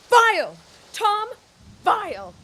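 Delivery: loud, disgusted outburst; outraged. Acted. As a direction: spit the word out in disgust. Diana outraged at Tom's claim.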